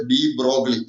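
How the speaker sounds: de Broglie said as "dee BROG-lee"; de Broglie read as spelled, ending in "-glee"; mispronounced name. Shown as a demonstration. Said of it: "some people are wrongly called".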